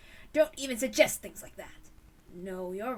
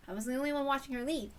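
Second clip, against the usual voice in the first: ordinary talk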